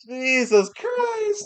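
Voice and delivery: high, drawn-out wail; mock exasperation. Diners worn down by the overeager waiter, mock exasperation. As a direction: stretch both words out in high despair.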